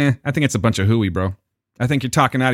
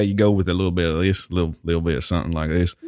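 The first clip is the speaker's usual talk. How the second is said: Southern accent; 6 semitones lower than usual. Shown as a demonstration. Showing a friend his select voices, Southern accent. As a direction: slip a little into Southern. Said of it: "for that type of thing".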